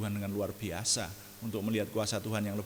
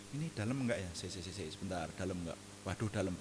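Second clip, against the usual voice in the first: timid hesitation; 3 semitones lower, 7 dB quieter than usual. Pretend. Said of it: "Saya pasti akan memasukkan kaki".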